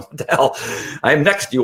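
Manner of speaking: lightly voiced puzzled dog; baffled dog. Trainer voices dog feeling stimulation beside him, mildly baffled.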